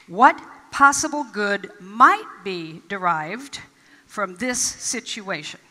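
drawn out slowly, rising on might; slow repeated question. Shown as a demonstration. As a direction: ask it again, savouring each word. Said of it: "ask this question repeatedly".

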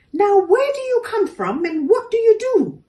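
high, loud bewildered squeak; a baffled kangaroo quizzing a stranger; puzzled kangaroo. A narrator's reading. Kangaroo puzzling over a hopping door, high and loud. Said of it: "said a tall, confused, grey and brown, plump kangaroo".

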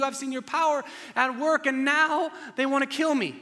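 high, loud, anguished plea to God; desperate voice. Acted. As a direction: cry out to God in despair, pitched high. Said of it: "Elijah replies in this desperate voice".

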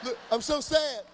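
slow, loud fake pout; mock sad selfie. Comedian mocks teen selfie caption, slow, loud fake pout. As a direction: ham up the sad face, slowly.

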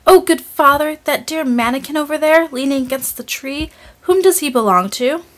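put-on high, eager little girl's voice; a girl marvelling at the doll, asking her father in an excited high voice; excited exclamation. A narrator's reading. Little Marie exclaims to her father, high and eager. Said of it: "she exclaimed without daring to touch him".

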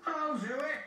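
high-pitched keen engine voice; volunteer it high and excited; eager. A narrator's reading. James volunteering to the Fat Controller, eager.

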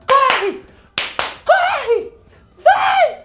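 very high, screamed urging; frantic high-pitched cheering shouts; football fan yelling. Retold or imitated. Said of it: "E eu, igual futebol, eu fico".

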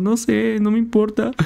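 voz mucho más aguda de niño quejica; suplícale a papá como niño; niño quejumbroso. Retold or imitated. (niño chillón hablándole a su papá)

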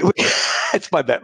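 normal talking voice

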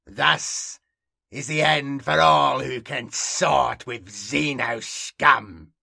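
loud, slow theatrical verdict; hammed-up villainous pronouncement with contempt; menacing proclamation. Theatrical sign-off after story.

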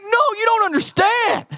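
worried protest. Retold or imitated. Husband to calm wife, alarmed insistence. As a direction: insist she doesn't get it, alarmed. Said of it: "And I'm like".

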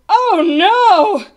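high, loud drawn-out cry; gasped horror. A narrator's reading. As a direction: cry out in horrified protest. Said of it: "gasped Bartholomew".

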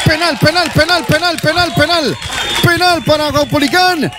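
rapid repeated excited shouting; excited penalty call. Real, not acted.

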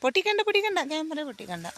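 sharp, very high cry of alarm; urgent protest. Real, not acted. Mother urgently stops her daughter from breaking the camera. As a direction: stop her quickly before she breaks it.

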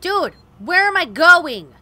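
loud, slow, exasperated yell; loud drawn-out frustrated shout. Real, not acted. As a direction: bellow it at the maze. Lost in game, frustrated.

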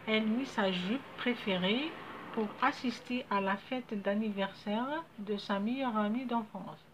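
slow, careful model sentence; deliberate classroom dictation pace; slow dictation reading. Shown as a demonstration. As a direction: read it out slowly for the pupils.

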